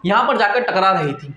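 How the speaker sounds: usual speaking voice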